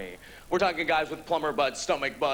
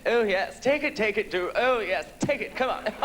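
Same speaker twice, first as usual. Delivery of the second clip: higher, mock-posh British accent; crisp British accent; 3 semitones higher than usual. British leatherman talking tough in bed, crisp British accent. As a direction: imitate a crisp British lover urging him on. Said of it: "come out with a crisp British".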